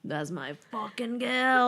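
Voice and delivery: bad Australian accent. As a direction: have another hammed-up go at an Australian accent. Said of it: "he has this Australian accent".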